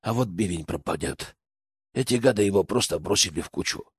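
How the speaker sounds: low, soft lament; sad. A narrator's reading. Boyar mourning his tusk thrown on a heap, sadly. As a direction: grumble mournfully, quiet and low. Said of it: "печально протянул он".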